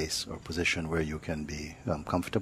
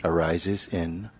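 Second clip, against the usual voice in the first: guided meditation; 3 dB quieter than usual. Acted. Teacher leads sitters to notice body and breath, unhurried meditative delivery. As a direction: guide them gently inward, leaving silence between phrases.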